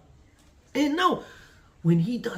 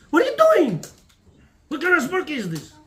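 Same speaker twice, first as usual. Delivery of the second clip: much higher, louder outburst; incredulous shout; 5 semitones higher, 6 dB louder than usual. Acted. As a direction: shout it in disbelief. Mocking the feuding guests.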